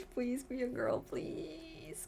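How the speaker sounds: whiny high please trailing off; mock pleading. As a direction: plead with Silu like a lovesick fan. Begging Silu to be a girl.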